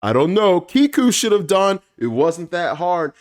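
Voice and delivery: angry swordsman's jab; mock furious. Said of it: "He is furious".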